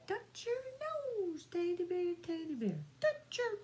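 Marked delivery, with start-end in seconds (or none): none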